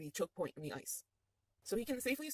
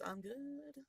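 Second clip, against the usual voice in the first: tipsy high answer; 6 semitones higher, 8 dB quieter than usual. Acted. Tipsy Eileen lifts empty flask.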